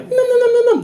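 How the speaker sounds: very high squeaky nibbling; squeaky squirrel nom. Retold or imitated. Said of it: "she's like".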